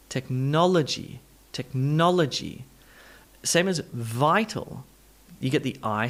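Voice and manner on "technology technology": technology as tek-NOL-uh-jee, falling; full NOL, then a quick uh in technology; schwa after stress. Shown as a demonstration. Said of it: "becomes an "uh".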